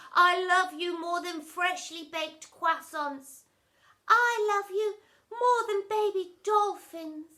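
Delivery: soppy declarations. A narrator's reading. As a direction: coo each line to each other. Family declaring love.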